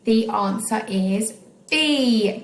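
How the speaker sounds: ordinary talk, nothing marked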